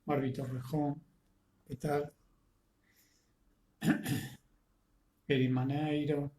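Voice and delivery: ordinary delivery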